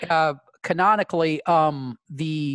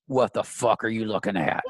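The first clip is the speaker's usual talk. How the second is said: low challenge, not raised; lightly voiced tough talk, pitched down; Clint, confrontational; 5 semitones lower than usual. Clint challenging a diner at another table, low confrontational voice. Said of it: "and, and he says".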